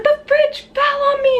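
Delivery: panicked. Retold or imitated. Retold panicked answer repeated.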